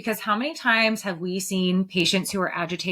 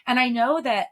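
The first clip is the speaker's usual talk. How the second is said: ordinary delivery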